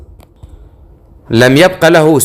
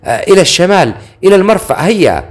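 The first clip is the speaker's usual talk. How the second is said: high-pitched hurried order; urgent mock shout; 6 semitones higher, faster than usual. A narrator's reading. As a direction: call out to a driver, voice pitched up. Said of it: "فهرع إليها وصاح".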